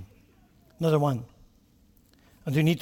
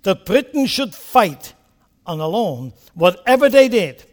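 grave parliamentary address; Churchill quote; 6 semitones higher, 8 dB louder than usual. Retold or imitated. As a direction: recount the warning with scorn. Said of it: "He said".

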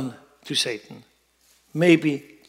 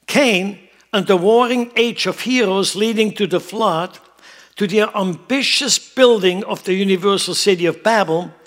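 loud, raised preacher's reading voice; emphatic sermon reading; 5 semitones higher, 7 dB louder than usual. Acted. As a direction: proclaim the written passage to the congregation. Reads a quotation out in preaching.

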